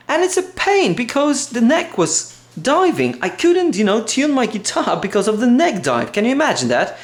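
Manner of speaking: high-pitched, incredulous complaining; can't-believe-it frustration, voice pushed high; exasperated rant. Real, not acted. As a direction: vent in real exasperation, pitch riding well up. Ranting about untunable guitar.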